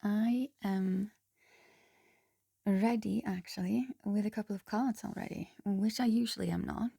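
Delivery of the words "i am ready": ordinary delivery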